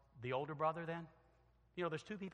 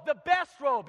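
calling out orders; 10 semitones higher, 14 dB louder than usual. Acted. Father orders servants.

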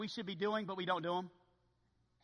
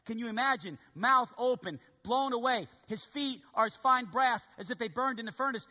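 ordinary talk

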